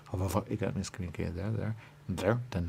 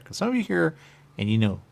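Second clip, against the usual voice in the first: emphatic; 10 semitones higher, 10 dB louder than usual. Real, not acted. Sudden emphatic burst.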